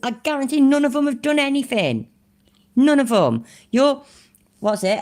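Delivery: high, worked-up rant; raging. Real, not acted. YouTuber dismissing followers who do nothing, raging. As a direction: let exasperation drive the pitch way up. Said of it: "I try not to rage too much".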